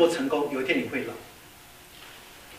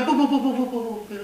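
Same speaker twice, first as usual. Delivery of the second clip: higher, rapid string of no's fading out; frantic refusal; 8 semitones higher than usual. Acted. Pilot refusing to fly higher, frantic higher no's. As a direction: protest in a comic panic.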